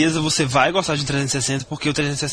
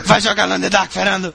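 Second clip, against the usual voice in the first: mock order; 4 semitones higher, 3 dB louder, faster than usual. Acted order to suffering friend.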